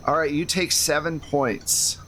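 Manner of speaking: ordinary talk, nothing marked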